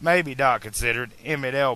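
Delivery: ordinary delivery, nothing marked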